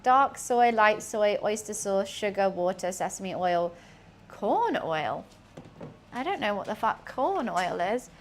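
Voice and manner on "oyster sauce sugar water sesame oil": usual speaking voice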